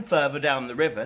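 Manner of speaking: ordinary delivery, nothing marked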